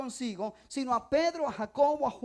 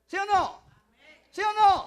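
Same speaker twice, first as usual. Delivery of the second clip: loud, higher call to the crowd; 6 semitones higher, 8 dB louder than usual. Real, not acted. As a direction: demand an answer from the congregation. Preacher calls to congregation.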